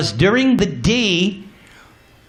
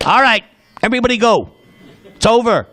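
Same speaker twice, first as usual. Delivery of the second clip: bossy mother; 5 semitones higher, much faster than usual. Acted. Yashoda sends guests home.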